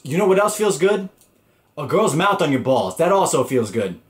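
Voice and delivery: high, loud teasing question; spring the punchline on him; cocky comeback. Retold or imitated. Chris firing back at Sean, high and cocky.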